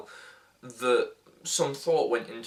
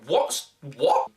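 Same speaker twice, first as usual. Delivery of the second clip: put-on drunk Bane voice; 4 semitones higher, 7 dB louder than usual. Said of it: "drunk Bane".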